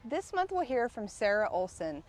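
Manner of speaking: usual speaking voice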